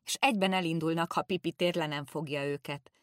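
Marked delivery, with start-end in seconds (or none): none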